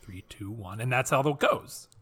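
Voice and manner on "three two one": hushed countdown under the breath; quiet countdown. Shown as a demonstration. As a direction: count down softly before speaking. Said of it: "I need to count down every single sentence I say".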